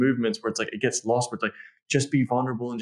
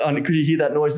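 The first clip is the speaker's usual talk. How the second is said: usual speaking voice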